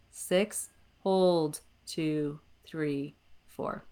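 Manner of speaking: soft, slow guided breath count. Shown as a demonstration. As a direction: count the breaths calmly, lengthening the exhale. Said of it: "Let's try one of those".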